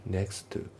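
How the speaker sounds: "next to" as "neks-tu"; dropped T. Shown as a demonstration. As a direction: merge the Ts in "next to" into one. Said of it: "nextのTが抜けますね".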